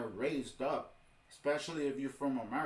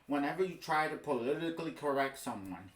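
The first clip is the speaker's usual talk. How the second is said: usual speaking voice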